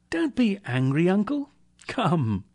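high, placating appeal; coaxing nephew. A narrator's reading. Nephew inviting his angry uncle, coaxing.

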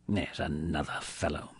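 low, quiet grumble; muttered. A narrator's reading. Scrooge overhearing his clerk's greeting, muttering. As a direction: grumble it under the breath. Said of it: "muttered Scrooge".

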